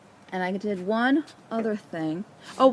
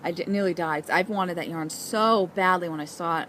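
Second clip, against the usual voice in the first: ordinary talk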